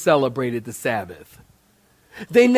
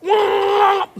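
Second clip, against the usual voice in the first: high, squeaky cartoon yell of rage. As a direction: shriek like a cartoon whose eyes pop out. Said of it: "when somebody gets really mad".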